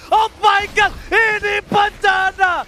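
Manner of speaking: high, loud hype shouting; excited shout. Real, not acted. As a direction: scream the teamfight to the crowd. Caster screaming at a teamfight.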